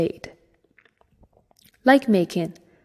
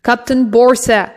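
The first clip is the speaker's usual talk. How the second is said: louder, crisp military answer; snapped report; 4 semitones higher, 6 dB louder than usual. Acted. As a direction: snap it out like a salute. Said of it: "Mekin snapped a salute".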